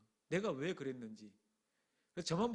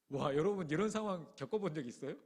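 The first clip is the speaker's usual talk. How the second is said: ordinary talk, nothing marked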